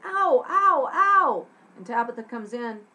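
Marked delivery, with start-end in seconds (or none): 0.0-1.5 high, loud yelps of pain; hammed-up repeated ows. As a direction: yelp as if your foot got hit. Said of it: "jumping around because something hit her".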